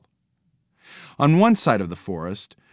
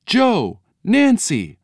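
high, amazed calling of names; gasped call; 11 semitones higher, 4 dB louder, slower than usual. A narrator's reading. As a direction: cry out to them in a high voice. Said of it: "they gasped".

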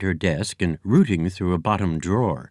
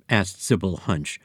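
ordinary delivery

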